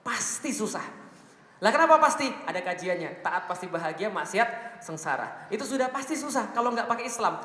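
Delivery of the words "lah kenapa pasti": loud challenge. Real, not acted. Rhetorical challenge to audience.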